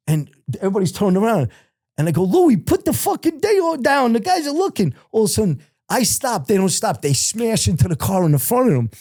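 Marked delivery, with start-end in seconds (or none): 2.2-4.9 high, urgent hushed plea to a friend; frantic warning in the car, pitched way up; panicked urging. Retold or imitated. Panicked driver next to cop.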